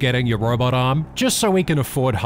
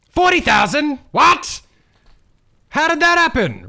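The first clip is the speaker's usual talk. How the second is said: much higher, louder stunned shout; shocked outrage; 5 dB louder than usual. Real, not acted. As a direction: cry out at the absurd price. Shocked at the price.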